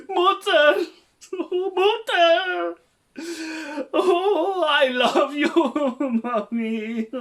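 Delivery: high, loud laugh-crying wail; overacted grief with laughter; hammy wailing. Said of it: "let's try something".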